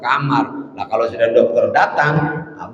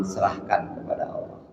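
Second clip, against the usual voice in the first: soft breathy whisper, dropping away; 6 semitones lower, 14 dB quieter than usual. Retold or imitated. Preacher whispers the fatalist's surrender to God. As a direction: hush it as if giving up on treatment. Mocking fatalist who refuses treatment.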